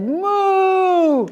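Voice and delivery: long, high storybook moo; cow's moo. A narrator's reading. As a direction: draw out a big high moo. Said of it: "So the cow said".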